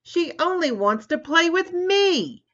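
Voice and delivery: an enraged brother shrieking back at his sister; angry shriek. Acted. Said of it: "shrieked Tommy".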